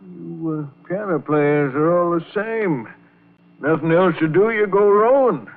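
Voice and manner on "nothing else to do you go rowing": higher, incredulous grumble building louder; scoffing. Acted. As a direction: throw his excuse back at him in disbelief. Old Gus scoffs at rowing plan.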